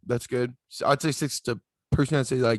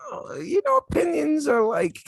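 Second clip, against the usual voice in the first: very high put-on cartoon voice; Coach Steve. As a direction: push the voice way up high, playing a goofy character. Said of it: "you sound like Coach Steve there".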